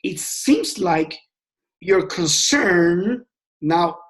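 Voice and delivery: slow, deliberate phrasing; slow model line for a sales call; slow demo. Shown as a demonstration. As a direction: lay each word down slowly and gently. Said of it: "you wanna say".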